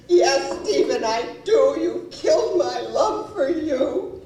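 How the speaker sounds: loud, high-pitched dramatic declaration; acted impassioned wife. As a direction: fling the accusation as the wronged wife. Voices wife's dramatic declaration.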